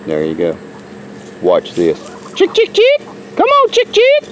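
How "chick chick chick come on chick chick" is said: high sing-song chicken call. Real, not acted. Calling the flock.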